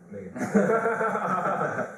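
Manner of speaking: high laughing exclamation. Real, not acted. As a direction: burst out laughing at the name. Laughing at friend's name.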